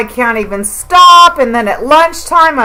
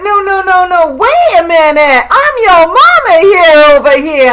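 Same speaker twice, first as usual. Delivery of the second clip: mock mama; 4 semitones higher, 4 dB louder than usual. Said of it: "And I'm like".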